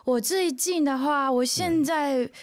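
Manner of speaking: ordinary talk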